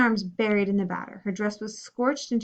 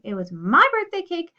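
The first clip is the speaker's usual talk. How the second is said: angry; 6 semitones higher, 6 dB louder than usual. Acted. Angry sister scolding after cake ruined.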